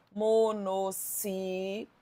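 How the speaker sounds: "monossílabas" very slowly as mo-no-ssí-la-bas; syllable by syllable. Shown as a demonstration. Teacher writing out the term, "monossílabas" broken into slow syllables. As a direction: draw out each syllable of "monossílabas".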